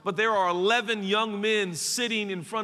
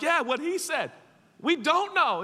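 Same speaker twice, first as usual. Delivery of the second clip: chiming-in agreement; 7 semitones higher than usual. Acted. Voices other disciples backing Thomas.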